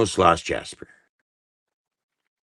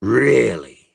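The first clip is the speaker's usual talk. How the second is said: louder incredulous repeat; 4 semitones higher, 5 dB louder than usual. Real, not acted.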